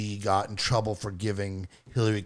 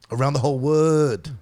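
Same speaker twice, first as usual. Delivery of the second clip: mock Chinese accent; 4 semitones higher, 5 dB louder than usual. Shown as a demonstration. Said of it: "What I used to do".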